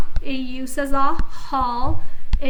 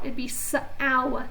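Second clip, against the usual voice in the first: ordinary talk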